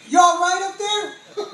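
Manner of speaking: loud, high, slow call of concern; worried shout. Retold or imitated. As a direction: shout up to check he's okay. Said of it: "Everybody like".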